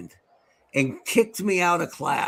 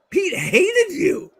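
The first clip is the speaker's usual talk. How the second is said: slow, higher, breaking into laughter; laughing; 10 semitones higher, 3 dB louder, much slower than usual. Retold or imitated. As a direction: laugh through the words. Telling off the teacher, laughing.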